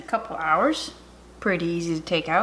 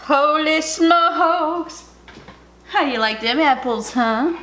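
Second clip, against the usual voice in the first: high, drawn-out amazed exclaim; excited; 7 semitones higher, much slower than usual. Real, not acted. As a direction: stretch it out in delight. Big reveal of the dish.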